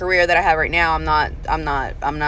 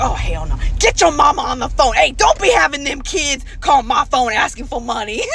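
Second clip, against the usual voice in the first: loud, higher mock grandpa rant; a fed-up granddad yelling down the phone; grumpy grandpa shouting; 6 semitones higher, 3 dB louder than usual. Grandpa scolds the family by phone.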